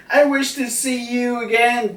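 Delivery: high child's voice, lightly voiced; child's message. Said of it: "the original message was".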